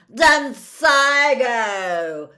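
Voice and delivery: long drawn-out screamed curse; screamed spell. A narrator's reading. Malfoy firing back at Harry, drawn-out scream. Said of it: "screamed Malfoy".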